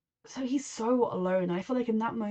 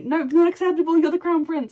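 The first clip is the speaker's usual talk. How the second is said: higher, louder mock stern refusal; stern queen; 8 semitones higher, 10 dB louder than usual. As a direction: snap it like a cold queen mother. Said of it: "You don't say".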